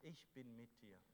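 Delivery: usual speaking voice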